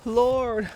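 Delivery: a loud gasping cry of Lord; gasped. A narrator's reading. Martha cries out to Jesus, gasping. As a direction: burst out Lord in grief. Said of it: "she gasped".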